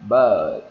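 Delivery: "But" loudly stretched, falling, with a D ending; long-vowel demo of "But", pushed loud; lengthened vowel. Shown as a demonstration. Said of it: "chúng ta kéo dài ra".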